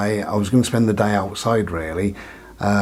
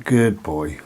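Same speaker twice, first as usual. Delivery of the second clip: praising dog; 5 semitones lower than usual. Real, not acted.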